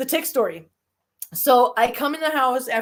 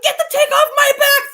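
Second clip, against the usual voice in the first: high loud pleading shout; desperate yell; 8 dB louder than usual. Retold or imitated. To her brothers in the living room, desperate high shout. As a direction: beg loudly for help. Begging brothers to remove tick.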